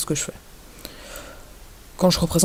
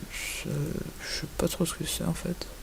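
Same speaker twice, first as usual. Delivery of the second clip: much softer, slowed, half to himself; muttered aside; 13 dB quieter than usual. Real, not acted. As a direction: mumble it unsure, under your breath. (marmonné pour soi, hésitant)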